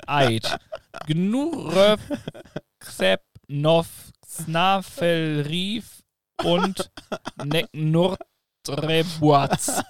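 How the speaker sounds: "Eid Gnuröw Ksepnow Snafelrief und Neknurtrebuaz" read slowly, as reversed German; spoken backwards. Shown as a demonstration. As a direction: sound out "Neknurtrebuaz" syllable by syllable, slow and loud. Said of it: "einen Satz rückwärts zu machen".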